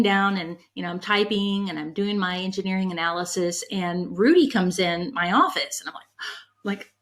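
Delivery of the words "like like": starstruck gasp. Retold or imitated. Starstruck as the CEO walks in.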